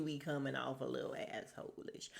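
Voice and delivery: whispered aside. Real, not acted. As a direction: breathe it as a secret. Confiding aside about Philip.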